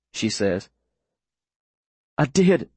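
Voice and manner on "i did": coughing. Acted. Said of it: "I say, coughing".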